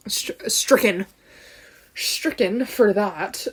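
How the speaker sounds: usual speaking voice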